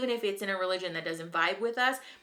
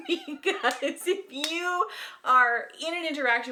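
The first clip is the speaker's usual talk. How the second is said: laughing; 9 semitones higher, 4 dB louder than usual. Real, not acted. Laughs after life coach bit.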